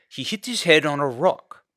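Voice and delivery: anxious dad explaining; father's voice. A narrator's reading.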